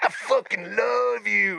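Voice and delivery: put-on high, drawn-out drunken declaration; mock tearful drunk. Maced cellmate to the narrator, mock tearful affection. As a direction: mimic a weepy drunk, slow and high. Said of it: "With all his tears coming out".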